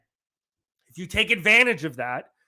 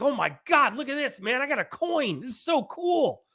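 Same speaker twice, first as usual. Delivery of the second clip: excited; 6 semitones higher than usual. Retold or imitated. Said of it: "And I was like".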